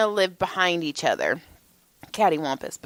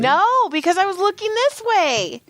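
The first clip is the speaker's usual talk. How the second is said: high, emphatic self-defense; exasperated protest; 11 semitones higher than usual. Real, not acted. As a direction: protest with pitch shot way up. Exasperated answer to co-host's question.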